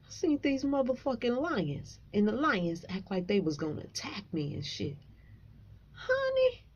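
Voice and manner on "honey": much higher, squealed "Honey"; thrilled high cry; excited squeal. Real, not acted. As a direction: shriek it with delight. Recalls not fearing the lions.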